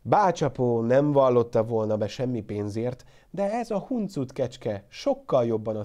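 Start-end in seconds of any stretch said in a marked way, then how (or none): none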